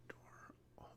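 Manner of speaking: under-the-breath whisper. Real, not acted. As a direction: read it under your breath. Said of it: "read, um, sort of under my breath".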